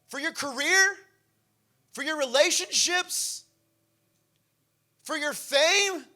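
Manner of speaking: loud, high preaching, slowing on the last questions; preaching at full force. Acted. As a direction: hammer each question at the congregation. Preaching climax to congregation.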